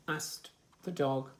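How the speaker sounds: ordinary delivery, nothing marked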